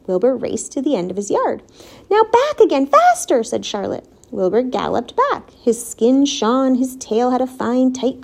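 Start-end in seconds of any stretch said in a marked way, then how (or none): 2.1-3.4 high, loud urging, climbing higher; urging command. A narrator's reading. Charlotte drilling the pig across the yard, commanding. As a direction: spur them on like a coach.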